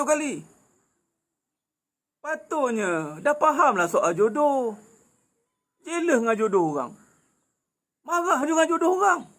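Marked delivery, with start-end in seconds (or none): none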